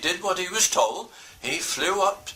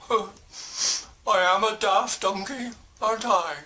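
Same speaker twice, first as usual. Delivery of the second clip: daft donkey; 5 semitones higher than usual. Acted. Donkey laughs at himself.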